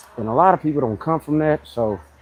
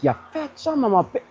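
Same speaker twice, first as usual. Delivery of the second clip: Bernie Mac style insult; 11 semitones higher than usual. Retold or imitated. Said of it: "They'll be like".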